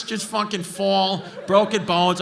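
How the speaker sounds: usual speaking voice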